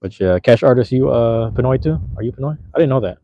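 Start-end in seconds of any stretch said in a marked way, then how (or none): none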